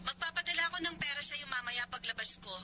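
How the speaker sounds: very faint telephone voice; voice on the phone. Acted. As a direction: reassure from the far end of a call. Heard from the other phone end. (napakahinang boses sa telepono)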